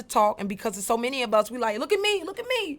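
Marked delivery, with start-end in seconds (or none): 1.8-2.8 put-on kids clamoring. Siblings clamoring for attention.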